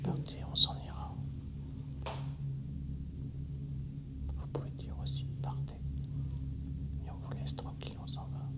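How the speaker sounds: soft unvoiced whisper to a spirit. Real, not acted. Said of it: "je murmure".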